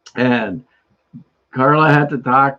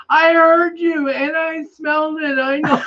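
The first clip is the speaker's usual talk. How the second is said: put-on insistent little girl; 14 semitones higher than usual. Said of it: "the little girl goes".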